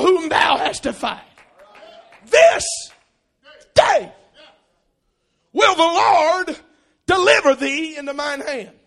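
loud, high, slowing on "this day will the Lord"; thundering battle speech. Retold or imitated. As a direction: proclaim it like a war cry. David's speech to Goliath.

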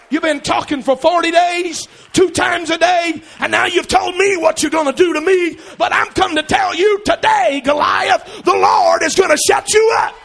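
loud, high, shouted "the Lord is gonna shut you up"; fighting words. Shown as a demonstration. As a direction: hurl the threat at Goliath. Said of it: "sounds a little more like fighting words".

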